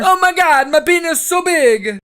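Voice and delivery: high, loud ecstatic exclaim; hammed-up joy. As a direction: overact a miracle. Plant overacting miracle.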